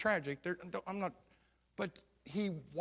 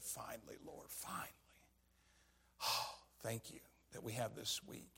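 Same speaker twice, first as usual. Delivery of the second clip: soft near-whispered prayer of relief, much softer; hushed thankful prayer; 4 semitones lower, 7 dB quieter than usual. Retold or imitated. Father quietly thanking the Lord on the lake, hushed. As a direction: breathe it out like a grateful private prayer.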